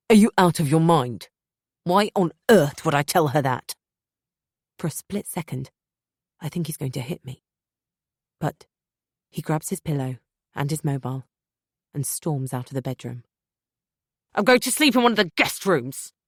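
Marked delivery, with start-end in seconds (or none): 0.1-3.7 louder incredulous retort; angry rebuff. Acted. Husband rebuffing wife, loud incredulous anger. 14.3-16.1 louder, higher parting yell. Acted. Husband storming out, louder parting yell. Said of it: "he yells as his parting shot".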